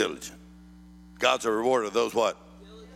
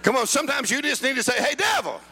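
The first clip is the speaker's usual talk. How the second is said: high, shouted revival sermon; fired-up preaching. Real, not acted. Preacher shows congregation how to defy the devil, fired-up preaching. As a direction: cry it out with bold authority.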